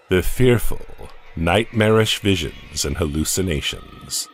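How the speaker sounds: usual speaking voice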